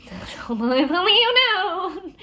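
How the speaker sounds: milk it like a tortured hero; hammed-up mournful sigh of a line; mock tragic. Jax's dramatic brooding line.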